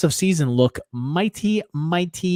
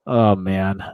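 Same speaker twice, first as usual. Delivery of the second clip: normal talking voice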